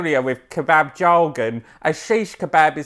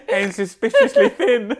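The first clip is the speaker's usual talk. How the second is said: laughing; 9 semitones higher than usual. Acted. Mock-dramatic punchline, laughing.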